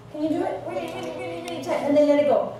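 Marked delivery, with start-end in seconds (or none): 0.7-1.8 high, strained and softer, squeezing hard; straining squeeze. Shown as a demonstration. Teacher showing kids how to tense up, strained. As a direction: squeeze every word out like clenching your fist. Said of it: "Do it really, really tight".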